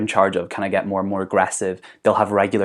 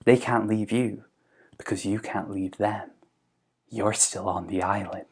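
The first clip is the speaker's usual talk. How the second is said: soft ominous voice; ominous hush; 7 dB quieter than usual. Retold or imitated. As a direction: let it sink in quietly. Jack's eerie final words.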